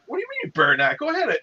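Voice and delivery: incredulous high coax; high-pitched urging. Retold or imitated. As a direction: push him to take it, voice up high. Urging friend to take beer.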